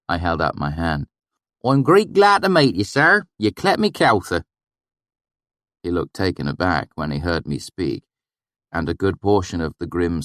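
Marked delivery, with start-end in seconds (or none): 1.6-4.5 valley dialect. A narrator's reading. Narrator faking the swineherd's dialect to gain trust, valley accent. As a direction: slip into the valley dialect to win him over. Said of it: "my sudden change in dialect".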